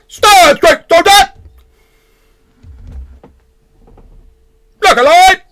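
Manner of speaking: very high, loud drill sergeant barks; parade-ground shouting. Acted. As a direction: bellow orders like a tiny drill sergeant. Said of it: "shouting in, like, this almost, like, parade ground fashion".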